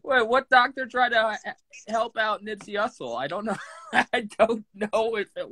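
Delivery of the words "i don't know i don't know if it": talking through laughter; giggly, slowed by real laughs; laughing. Real, not acted. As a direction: get the words out while cracking up. Cracking up during the argument.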